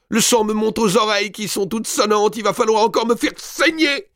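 high, loud, wailing sing-song; the overfed countess rants to her maid; raging countess. A narrator's reading. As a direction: moan about the blood rising. Countess raging, blood rising.

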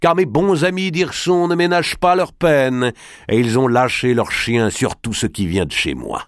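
a forceful lord giving urgent orders; Robert d'Artois, bold lord. A narrator's reading.

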